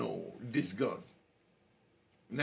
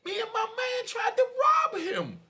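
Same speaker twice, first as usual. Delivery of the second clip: panicky high blurt; 4 dB louder than usual. Retold or imitated. Panicked cab driver blurts lie.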